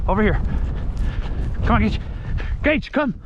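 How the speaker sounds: high firm recall; calling a dog. Real, not acted. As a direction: call the dog in.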